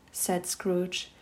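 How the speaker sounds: plain reading voice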